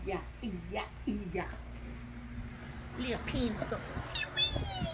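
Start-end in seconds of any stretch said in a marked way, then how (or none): 4.1-4.9 soft, much higher squeak; high toy voice. Pretend. Toy comforting little Lee, high and soft. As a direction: coo like a tiny toy soothing a friend.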